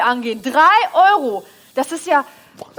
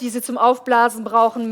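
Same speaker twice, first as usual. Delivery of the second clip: normal talking voice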